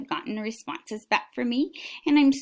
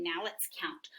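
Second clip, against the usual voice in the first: hushed unvoiced prompt; whispering; 5 semitones lower, 10 dB quieter than usual. Shown as a demonstration. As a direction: whisper the cue.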